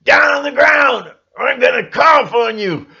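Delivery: loud, high mock shout; hammed-up robber shout; robber's yell. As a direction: yell at the bank customers. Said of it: "he's yelling at the people".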